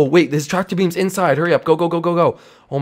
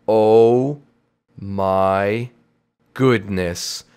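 slow realization; 11 semitones lower, much slower than usual. Acted. Slow realization of being alien.

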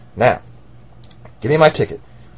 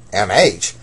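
ordinary talk

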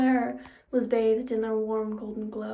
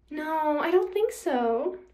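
slow, higher teasing refusal; taunting villainess; 5 semitones higher, slower than usual. A narrator's reading. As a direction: refuse with smug, drawn-out relish. Nemesis refuses to give it back.

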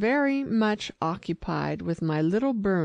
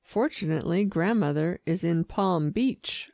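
ordinary delivery

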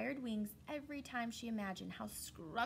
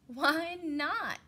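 protesting animals; 5 semitones higher, 8 dB louder than usual. Acted.

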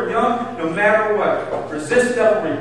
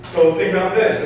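ordinary talk, nothing marked

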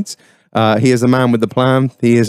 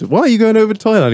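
higher, quick incredulous query; mildly mock-incredulous, rattle it off; retold question; 9 semitones higher, faster than usual. Asking pest control man about Thailand, quick and high. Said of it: "And I said".

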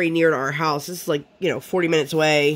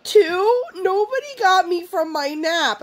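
put-on weepy toddler. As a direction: mimic her teary toddler voice. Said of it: "and then she goes".

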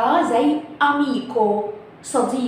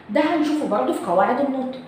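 normal talking voice

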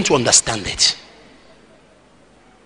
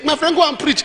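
higher, chuckling through the words; amused retold reply; laughing quote; 9 semitones higher than usual. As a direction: laugh as you pass on the invitation.

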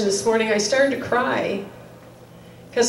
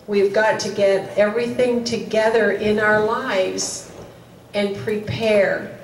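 usual speaking voice

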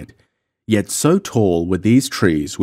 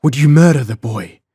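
higher and louder pleading outcry; desperate cry; 6 semitones higher, 7 dB louder than usual. A narrator's reading. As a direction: shout it to stop him. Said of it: "she cried".

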